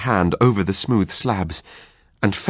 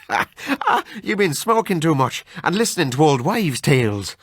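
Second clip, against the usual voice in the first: lightly voiced Irish friend, laughing it off; mild Irish brogue mocking old wives' tales; hearty Irish doctor, amused; 8 semitones higher than usual. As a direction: scoff like a jolly Irishman. Narrator voices Kelly.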